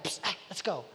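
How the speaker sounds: quick, low-key psst to rouse someone; hushed urging. Retold or imitated. Angel rouses sleeping Peter in prison, hushed urging. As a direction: play a softly spoken nudge awake.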